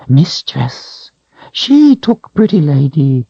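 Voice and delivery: high whining creature voice; creature whine. A narrator's reading. Creature whining to Richard about the Calthrop. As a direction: whine it out, cringing.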